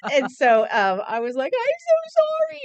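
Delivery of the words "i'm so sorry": put-on high, slow little-girl plea; child hugging her dad, high and slow; tearful child apology. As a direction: apologize like a sobbing five-year-old.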